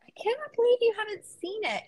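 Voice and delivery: high-pitched disbelief; incredulous. Real, not acted. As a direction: squeal it in amazement at a friend. Amazed friend never saw the film.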